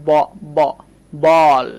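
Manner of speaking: "ব, ব, বল": the open "অ" alone twice, then the whole word loud; teacher breaking down "ব, ব, বল" with pauses; sounding out. Shown as a demonstration. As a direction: isolate "ব" as "baw", pause, then stretch "বল". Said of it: "অ sound লুকিয়ে আছে".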